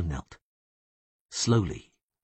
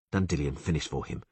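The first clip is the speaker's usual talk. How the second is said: usual speaking voice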